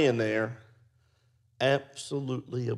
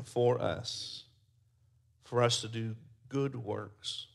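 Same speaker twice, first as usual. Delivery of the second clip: closing prayer; 3 dB louder than usual. Acted. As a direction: pray over the congregation.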